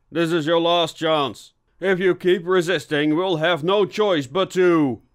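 high, loud final warning; loud ultimatum. Acted. Inspector to hidden suspect, calling out a last warning. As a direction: build up a threat for all to hear.

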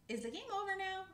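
high, loud little squirrel; high frightened squirrel pup; Dottie's voice. Acted. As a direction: ask it high and worried.

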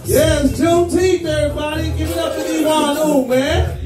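ordinary talk, nothing marked

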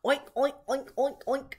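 playful string of oinks; boy's pig oinks. A narrator's reading. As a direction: oink like a boy playing pig. Boy playing at being a pig.

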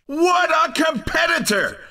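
high-pitched, excited announcer; ringing, excited victory cry; triumphant winner call. Acted. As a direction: marvel at the champion. Announcer calls the race winner.

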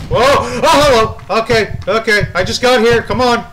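loud, high-pitched alarmed protest; startled yelling. Real, not acted. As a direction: jolt into loud, high panic. Startled by pursuer in game.

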